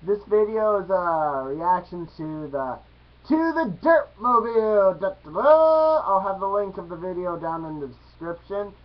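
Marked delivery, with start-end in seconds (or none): 3.3-6.0 loud, rising fanfare call; mock heroic call. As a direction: call it out like a mock superhero, then belt the fanfare.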